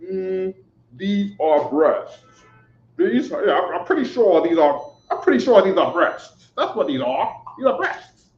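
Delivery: lightly voiced scholar squinting at pictures, rising to a squeak; mock pondering scholar. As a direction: act the unsure expert talking himself into it. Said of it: "and he says".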